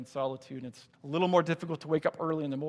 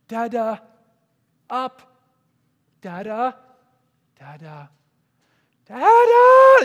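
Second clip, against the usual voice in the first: put-on toddler calling; 8 semitones higher, 18 dB louder, much slower than usual. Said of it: "And he's like".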